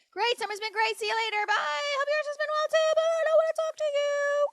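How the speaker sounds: sing-song it like you can't wait to leave; mocking dismissive voice. Mocking the ex's curt text to the audience, high sneering voice. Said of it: "That's the way he fucking texted me".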